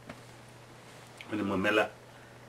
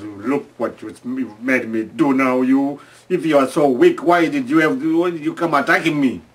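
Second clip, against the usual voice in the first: a louder, higher rebuke that climbs as it goes; scolding elephant; 3 semitones higher, 7 dB louder than usual. Retold or imitated. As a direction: tell off the weak victim, building up. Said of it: "Now he goes".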